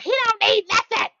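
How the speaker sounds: mimicking mom, way up high and shrill; squeaky high mom voice calling from another room; the mom shouting from the background, high mock squeal.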